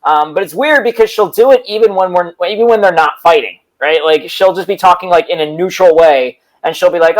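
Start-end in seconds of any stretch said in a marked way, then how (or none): none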